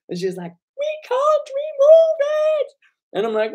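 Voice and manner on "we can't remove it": very high, loud mock squeal; straining woman bartender. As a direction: imitate a small voice straining over music. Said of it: "to give it more, more weight".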